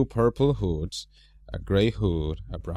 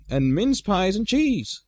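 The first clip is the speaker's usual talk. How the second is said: high, slow dwarf asking; 11 semitones higher, slower than usual. A narrator's reading. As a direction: drag out the order as a dwarf. Dwarf Bofur orders food.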